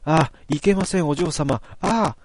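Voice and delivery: light startled protest rising to a cry; understated frightened wail; mild mock scream. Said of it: "続けざまにシノの叫びだ".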